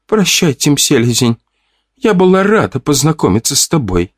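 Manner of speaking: выше, лёгкий тонкий девичий голос; тихо прощается, чуть детским голосом; тоненький голосок. A narrator's reading. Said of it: "от этого тоненького голосочка". (избитая девушка прощается с Тимом тоненьким голоском)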